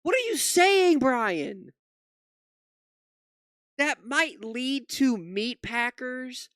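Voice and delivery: high, loud, slow disbelieving rant; exasperated outrage. Real, not acted. Streamer to host Brian, loud exasperated outrage. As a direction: plead with him, exasperated and appalled.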